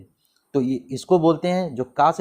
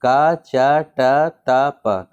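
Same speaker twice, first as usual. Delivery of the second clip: का चा टा ता प very slow, each syllable long and louder; 5 dB louder, much slower than usual. Shown as a demonstration. Teacher showing the memory trick, each syllable drawn out. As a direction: stretch out का, चा, टा, ता, प one at a time. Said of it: "ये तरीका होता है".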